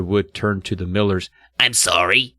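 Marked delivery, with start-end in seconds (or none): 1.6-2.4 raspy dummy. A narrator's reading. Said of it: "he rasped".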